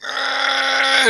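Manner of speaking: high, puzzled ogre hesitation; ogre stall. Acted. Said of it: "the ogre was like".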